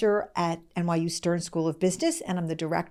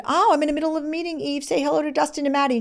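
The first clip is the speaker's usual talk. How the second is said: flustered greeting; 8 semitones higher, 3 dB louder, faster than usual. Retold or imitated. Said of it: "I said".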